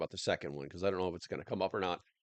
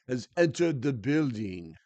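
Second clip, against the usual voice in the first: slow, grand entrance line with a light mock Russian-ish accent; a hint of the made-up Russian-ish accent; lightly hammed mock Russian announcement; 3 semitones higher, 5 dB louder, slower than usual. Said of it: "I just made up this accent".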